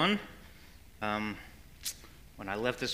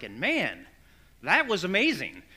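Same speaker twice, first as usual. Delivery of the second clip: higher, louder on "that was amazing"; retold amazement; 4 semitones higher, 4 dB louder than usual. Husband telling wife about the dancing video, retold amazement. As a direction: recount being impressed, with emphatic swoops.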